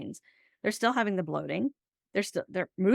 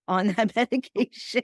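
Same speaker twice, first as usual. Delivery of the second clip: laughing through the words, louder; 3 semitones higher, 5 dB louder than usual. Real, not acted. Finishing her ex-husband's remark while laughing. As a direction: crack up mid-quote.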